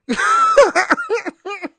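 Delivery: a high, loud burst of real laughter. Real, not acted. Vlogger cracking up while telling viewers about leaving work, loud laugh.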